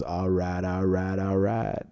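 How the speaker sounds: low, soft, slow all right; Matthew McConaughey drawl. Retold or imitated. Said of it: "What's his name that goes".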